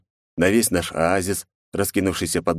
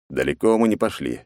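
ordinary delivery, nothing marked

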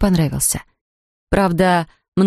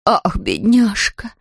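slow, tender cry of pity; drawn-out sympathy for the poor bear; pitying exclamation; 3 semitones higher, much slower than usual. A narrator's reading. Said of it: "воскликнула миссис Бёрд". (медленный жалостливый возглас)

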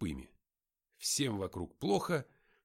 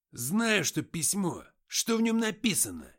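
louder, higher impatient demand; angry demand; 6 semitones higher, 6 dB louder than usual. Acted. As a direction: push him for an answer. Antokha demands the letter's contents.

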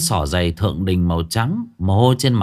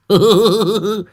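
1 gloating laugh; 11 semitones higher, 6 dB louder than usual. A narrator's reading. Old man gloats on phone.

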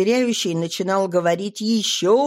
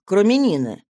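ordinary delivery

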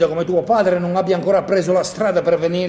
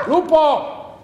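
sharp, much higher yell at the dog; shout at dog; 12 semitones higher, 5 dB louder than usual. Acted. Man yelling at the barking dog Lupo, sharp shout. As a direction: call the dog off with one loud cry.

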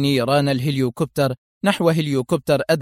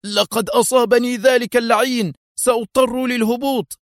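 high, strained cry of distress; alarmed shout; 10 semitones higher than usual. Acted. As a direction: shout while fighting the controls. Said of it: "فصاح قائدها".

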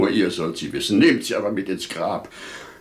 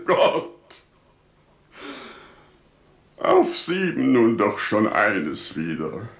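tearful thanks; sobbed prayer; 3 semitones higher, slower than usual. Acted. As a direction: overcome with grateful grief.

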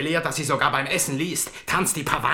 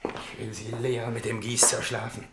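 low menacing mutter; 4 semitones lower, 8 dB quieter than usual. Acted. King muttering vengeance.

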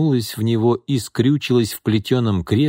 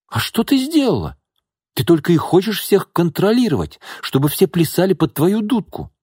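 high, sharp, indignant retort; heated retort; 8 semitones higher than usual. A narrator's reading. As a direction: hit back at the aunt. Katya fires back. (возмущённо)

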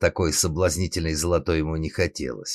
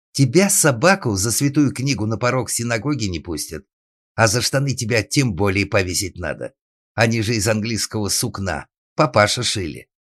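higher, loud indignant tirade; bawling; 5 semitones higher, 5 dB louder than usual. Acted. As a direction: bawl threats over the ruined trousers. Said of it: "орал".

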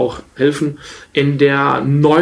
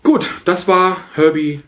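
normal talking voice

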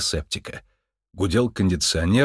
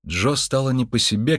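usual speaking voice

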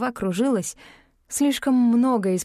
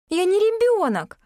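put-on much higher, indignant young girl; offended girl; 11 semitones higher, 3 dB louder than usual. A narrator's reading. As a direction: snort it like a hurt child. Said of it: "Алекса обиженно фыркнула".

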